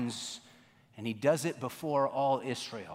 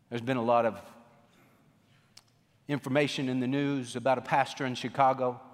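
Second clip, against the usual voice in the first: ordinary delivery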